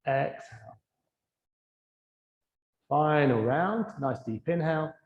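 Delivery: low, soft cues with long silences between; guided breathing meditation. Real, not acted. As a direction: calmly guide each breath, letting the words fade. Leads a breathing exercise.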